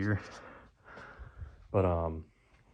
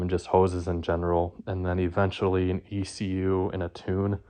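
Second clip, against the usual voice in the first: ordinary delivery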